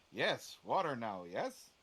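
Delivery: clipped words of a simple creature; a lightly voiced creature asking about water; odd creature speech. Layak voiced in broken speech.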